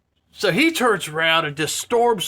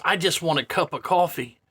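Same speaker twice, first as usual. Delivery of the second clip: usual speaking voice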